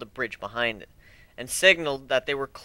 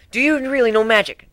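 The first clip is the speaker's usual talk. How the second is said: higher, louder, bursting with curiosity; eager Twilight; 8 semitones higher, 5 dB louder than usual. Acted. Twilight eagerly asks Griff about magic, excited. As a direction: blurt the question out at once.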